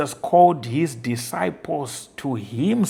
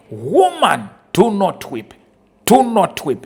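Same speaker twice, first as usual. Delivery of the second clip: raised, higher, louder pleading; Jesus comforting the widow; 5 semitones higher, 7 dB louder than usual. Acted. Preacher voicing Jesus to the grieving widow, loud and pleading. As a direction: call out a tender command not to weep.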